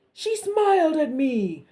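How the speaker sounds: loud indignant guard; insistent repeat. Acted. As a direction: stress it with outrage. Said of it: "repeated the soldier".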